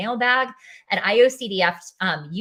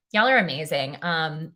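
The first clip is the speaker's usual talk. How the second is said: ordinary talk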